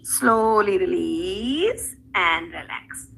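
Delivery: slow, soothing release cue; slow calm release. Shown as a demonstration. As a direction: ease them out of the pose slowly. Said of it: "yoga cue".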